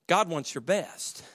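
ordinary talk, nothing marked

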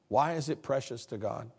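ordinary talk, nothing marked

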